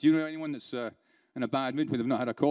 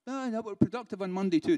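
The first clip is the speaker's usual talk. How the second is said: higher-pitched mock protest; objecting trainee; 5 semitones higher than usual. Trainer mimicking staff who claim Monday works, mock protest.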